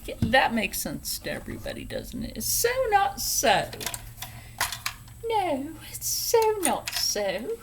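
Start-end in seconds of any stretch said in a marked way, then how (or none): 2.3-3.7 "so not so" in a hammed-up British accent, pitched higher and louder; playful mock British; horrible British accent. Shown as a demonstration. Sewing teacher jokes in a bad British accent. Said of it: "Sorry for my horrible British accent". 5.3-7.6 hammed-up posh British accent, pitched higher; playful mock British drawl; horrible British accent. Sewing teacher jokes in a bad British accent. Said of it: "Sorry for my horrible British accent".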